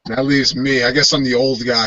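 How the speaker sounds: normal talking voice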